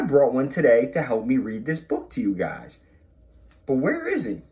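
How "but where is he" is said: high, wondering where is he; mock puzzled. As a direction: playfully search for the missing partner. Looking for hidden partner.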